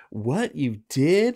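slow, high-pitched disbelief; mock amazement. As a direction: stretch it upward as astonished friends. Friends' amazed reply acted out.

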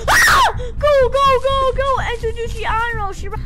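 high, loud go go go; panicked shout. Real, not acted. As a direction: yell to hurry, terrified. Chased by neighbor.

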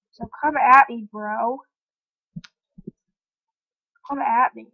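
loud playful taunt. Pretend. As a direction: dare them, cocky and loud. Taunting exploding creepers.

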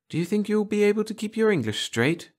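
put-on high-pitched little girl's voice; girlish voice. A narrator's reading. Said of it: "the chatter of girlish voices".

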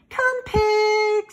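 high, loud sing-out farm call; long, ringing livestock call; calling a pig. A narrator's reading. Farmer calls pig with pail.

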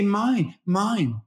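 put-on high falling baby-talk words; toddlerese. Shown as a demonstration. Parent voicing the toddler's claim in baby talk. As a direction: say it like the toddler would. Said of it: "You say".

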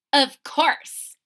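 proud reply; cat answering Chester proudly; confident cat. Acted. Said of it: "replied the cat".

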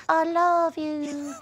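Russian woman mocking her American host. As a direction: stretch each word like a sappy cartoon. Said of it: "you sound like this".

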